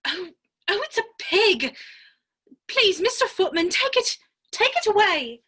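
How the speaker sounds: alarmed plea. Acted. Alice finds the baby is a pig.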